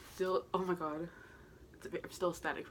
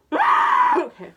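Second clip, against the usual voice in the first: much higher and louder shrieked okay; real scream; 19 dB louder than usual. Real, not acted. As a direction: let the scream out at full force. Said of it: "I need to scream into something".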